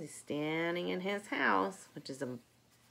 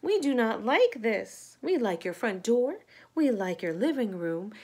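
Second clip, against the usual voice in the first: loud, protesting bees; upset complaint; 3 semitones higher, 7 dB louder than usual. Acted. Bees protesting to the mouse under the mud, loud. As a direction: complain loudly, buzzing with upset. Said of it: "cried the bees".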